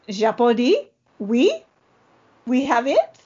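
a playful, hammed-up French accent; quoting a film line in a put-on French accent. Shown as a demonstration. Podcast host practising her French accent for her co-host, in a mock French accent. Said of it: "that was my French accent".

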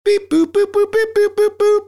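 very high, loud mouth beeps; phone dial tones. Retold or imitated. Hunter dialing his dad.

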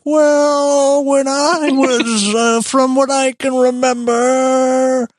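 put-on high, slow, quavery old-timer voice; old person voice. Shown as a demonstration. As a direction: creak it out like an elderly grandpa. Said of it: "in, like, a old person voice".

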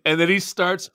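ordinary talk

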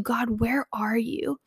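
whispered, slow, seeking "God, where are you?"; searching whisper. Shown as a demonstration. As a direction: breathe it out softly, scanning for God. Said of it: "if our perspective can shift to".

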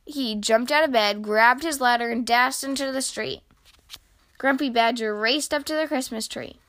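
ordinary delivery, nothing marked

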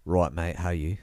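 botched British matey greeting; random British accent. Acted. Tolkien greeting Lewis at the pub, botched British accent. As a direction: put on a rough-and-ready British accent. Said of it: "do a random British accent".

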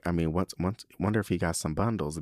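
ordinary talk, nothing marked

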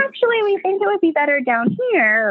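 high, mock-polite midwife voice; tactful suggestion. Midwife steering a client downstairs, high tactful retold voice. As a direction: pitch it up as careful, diplomatic advice. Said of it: "different things that we say".

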